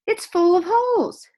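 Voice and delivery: high, loud dismayed cry; shocked exclaim. A narrator's reading. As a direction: wail it in horror. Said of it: "exclaimed Missus Rogers".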